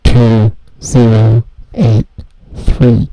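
loud but muffled phone voice. Acted. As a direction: talk as if through a wall. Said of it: "You sounded really muffled right there".

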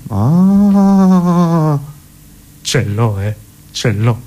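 long, drawn-out rising "Ah"; mock flash of inspiration; eureka moment. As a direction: hold the "Ah" as the idea dawns. Acting Fontana's sudden idea.

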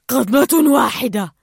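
loud, slow begging; pleading. Acted. Bruce begs the sharks for one bite, pleading loudly. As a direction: beg for just one bite. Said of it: "توسل بروس".

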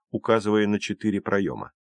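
ordinary delivery

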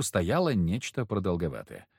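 plain reading voice

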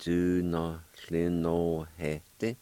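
Dunothlinohete drawn out very slowly, each syllable separate; slow model word. Shown as a demonstration. Teacher slowly modelling the word for learners. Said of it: "it'll sound like this".

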